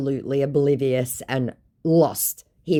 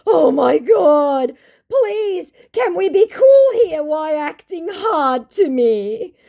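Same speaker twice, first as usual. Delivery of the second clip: high, drawn-out pleading scammer voice; hammed-up high begging voice; mock scammer plea; 7 dB louder, slower than usual. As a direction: whine it like a desperate con man. Voicing the scammer's pleading message.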